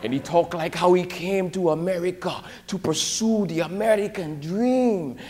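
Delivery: lightly put-on Ghanaian English accent. Retelling Albert Mansa's speech in a Ghanaian accent. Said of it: "so he talk like this".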